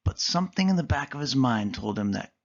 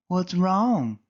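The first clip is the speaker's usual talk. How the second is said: higher concerned little voice; Callie asking; 7 semitones higher than usual. Acted. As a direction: ask with concern. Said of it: "Callie asked".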